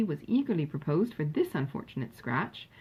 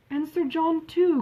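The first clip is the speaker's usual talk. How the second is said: higher, louder and slow, gushing over Sir John; eager cry; 10 semitones higher, 5 dB louder, slower than usual. A narrator's reading. Elder sister gushing about Sir John, eager higher cry. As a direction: cry it out with delight. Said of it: "cried the elder sister".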